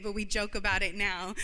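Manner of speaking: ordinary talk, nothing marked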